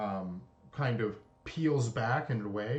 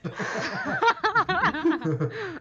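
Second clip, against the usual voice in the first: big laugh; 7 dB louder than usual. Real, not acted. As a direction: burst of loud high laughter. Player jokes Fire explodes.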